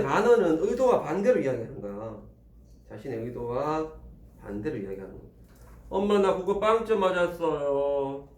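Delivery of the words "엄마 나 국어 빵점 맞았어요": 길게 늘여 말하는 아이 연기; 엄마에게 고백하듯 느리고 높게. Acted. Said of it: "엄마는?". (엄마에게 털어놓는 아이처럼 늘여서)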